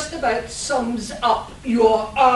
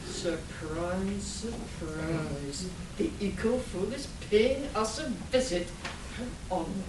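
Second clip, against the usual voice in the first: much softer, lower, smug and menacing; sinister villain murmur; 6 semitones lower, 10 dB quieter than usual. Acted. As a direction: purr it like a gloating mad scientist. Villain greets news of hero.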